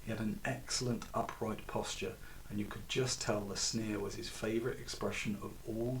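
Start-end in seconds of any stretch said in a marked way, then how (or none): none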